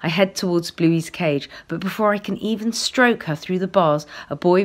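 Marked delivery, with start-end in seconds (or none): none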